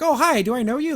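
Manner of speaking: mock Entrapta greeting strangers brightly; chirpy Entrapta. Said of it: "she's like".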